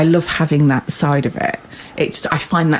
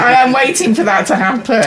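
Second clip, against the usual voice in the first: ordinary talk, nothing marked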